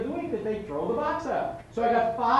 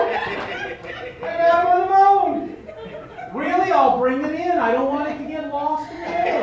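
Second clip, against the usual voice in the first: eager phone call; 7 semitones higher, 9 dB louder than usual. Acted.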